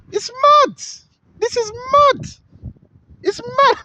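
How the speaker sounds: high, emphatic exclamation; can't believe it; real astonished outburst; exclaiming disbelief. Real, not acted. Reacting to the situation.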